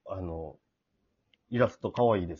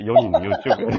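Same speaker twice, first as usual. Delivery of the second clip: loud, breaking into laughter; laughing; 9 dB louder than usual. Real, not acted. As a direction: laugh through the punchline. Joke ends in laughter.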